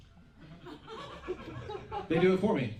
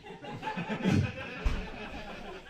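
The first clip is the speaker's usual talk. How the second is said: high breathy laugh; 6 dB quieter than usual. Real, not acted. Laughs at own ramble.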